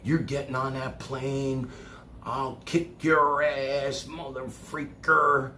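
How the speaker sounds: retold rant of the son at his father; imitating angry son. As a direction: ham up the son's rant. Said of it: "he was, like, crazy".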